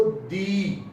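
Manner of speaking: दी stretched long and stressed; long stressed dee. Shown as a demonstration. Teacher demonstrating the long stressed दी to students. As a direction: draw out दी and lean on it. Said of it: "लंबा दी बोलते हैं".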